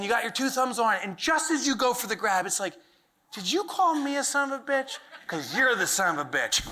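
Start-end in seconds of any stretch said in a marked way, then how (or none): 3.3-6.6 higher cartoon voice that drops at the end; cheeky creature sassing back; turtle head voice. The toothpaste blob answering an insult, cartoon voice.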